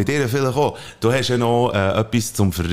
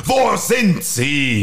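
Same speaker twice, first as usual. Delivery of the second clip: Batman demanding; 6 semitones higher, much slower than usual. Acted.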